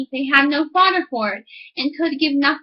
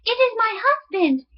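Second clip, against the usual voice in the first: alarmed wife; 8 semitones higher than usual. Acted. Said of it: "cried the woman".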